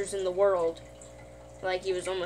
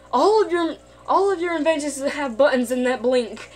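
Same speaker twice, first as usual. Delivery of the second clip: higher, louder whiny complaint; exasperated Morty; 4 semitones higher, 4 dB louder than usual. Retold or imitated. Morty griping at Rick about his inventions, whiny. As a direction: complain like a fed-up kid.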